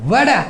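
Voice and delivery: American "ওয়াটার" with ট voiced as a d; American pronunciation. Shown as a demonstration. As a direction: say "ওয়াটার" as wada, no final r. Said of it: "American উচ্চারণ হচ্ছে টিটাকে ডি উচ্চারণ করে".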